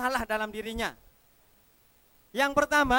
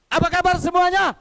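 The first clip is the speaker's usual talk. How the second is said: much higher, much louder rally cry; loud greeting shout; 6 semitones higher, 12 dB louder than usual. Shown as a demonstration. Speaker shouting a greeting to the whole hall. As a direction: bellow it at the whole crowd. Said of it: "Sekarang saya tes lagi".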